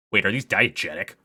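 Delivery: normal talking voice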